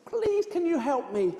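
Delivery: ordinary delivery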